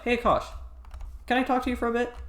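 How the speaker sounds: high, lightly imitated condescending teacher. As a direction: mimic her talking down to a student. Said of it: "talks to everybody really condescendingly".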